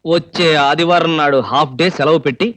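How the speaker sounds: low-pitched, even menace; deadpan threat. Acted. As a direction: lay out the plan in a low, level voice. Continues deadpan threat to kill.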